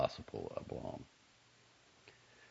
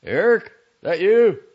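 loud, higher shout from another room; shouted call; 15 dB louder than usual. A narrator's reading. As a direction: call out across the apartment. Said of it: "a male voice shouted".

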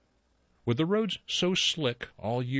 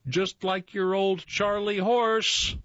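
high, loud, slow teasing holler; 9 semitones higher, 3 dB louder, slower than usual. Retold or imitated. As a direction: yell it across the field. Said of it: "teammates hollered".

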